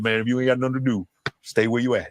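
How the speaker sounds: low, fast, lightly voiced grandma advice; hint of a Southern grandma scolding. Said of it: "my grandma said".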